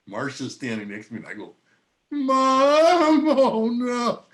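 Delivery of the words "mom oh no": a much higher, louder choked cry; imitated crying. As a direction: break into tears on the word Mom. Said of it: "I start crying".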